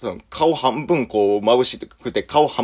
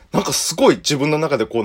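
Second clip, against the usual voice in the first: ordinary talk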